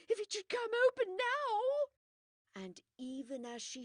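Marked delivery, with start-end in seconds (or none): 0.1-1.9 high, loud frightened cry; terrified cry. Acted. Widow to Poirot, high terrified cry. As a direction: cry out in dread of the door.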